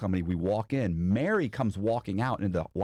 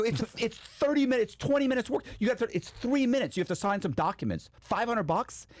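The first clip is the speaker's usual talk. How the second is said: high, disbelieving protest; retold haggling, pitched up in outrage; incredulous protest; 13 semitones higher than usual. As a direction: argue the price in disbelief. Arguing the price, outraged.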